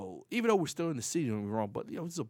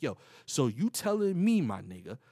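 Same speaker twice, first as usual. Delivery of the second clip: loud, raised challenge to a hustler; acted-out streetwise disbelief, hit hard; back-then street talk; 7 dB louder than usual. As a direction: confront him in your old street talk. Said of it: "I talk back then".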